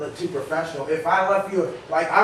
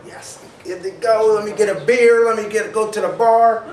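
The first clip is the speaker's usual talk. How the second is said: mock cocky college freshman, a bit higher and louder; 5 semitones higher, 5 dB louder than usual. Freshman out of his parents' home boasting, mock cocky. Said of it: "you're like".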